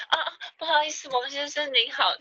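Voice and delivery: high, fast embarrassed apology; mock flustered service voice; flustered caller apology.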